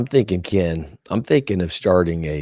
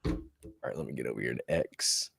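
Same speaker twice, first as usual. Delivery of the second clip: quick, low, half-whispered aside; soft absent-minded murmur; muttering to self; 9 semitones lower, 11 dB quieter, faster than usual. Real, not acted. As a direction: mumble it quietly as you search. Murmurs to self while navigating.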